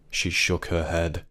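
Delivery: plain reading voice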